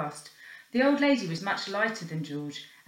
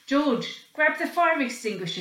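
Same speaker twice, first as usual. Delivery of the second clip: higher, louder urgent call; shout; 6 semitones higher, 4 dB louder than usual. Acted. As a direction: bark an order across the room. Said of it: "shouted the porter".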